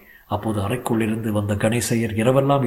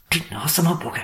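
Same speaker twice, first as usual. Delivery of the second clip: higher-pitched bitter muttered wail of a curse; lamenting curse; 6 semitones higher than usual. A narrator's reading. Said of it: "என்று அங்கலாய்த்தவாறே". (அங்கலாய்ப்புடன் சபிக்கும் குரல்)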